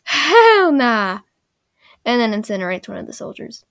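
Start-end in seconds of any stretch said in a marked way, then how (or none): 0.0-1.2 loud, high drawn-out refusal; mock defiant Zuko. Zuko rejecting the old man's lesson, mock defiant shout. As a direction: shout it as a comic outburst. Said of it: "And Zuko goes".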